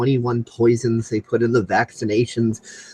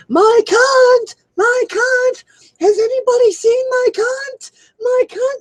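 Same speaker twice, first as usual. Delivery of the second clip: much higher, louder mock bartender yell calling a name; bartender screaming; 5 dB louder than usual. Retelling a prank call, the bartender screaming a name across the bar, high and loud. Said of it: "I hear her screaming through the bar".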